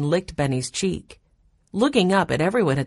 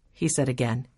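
ordinary delivery, nothing marked

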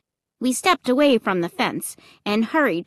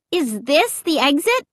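higher, puzzled cry; 6 semitones higher than usual. A narrator's reading. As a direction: call out the question in doubt. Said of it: "I cried".

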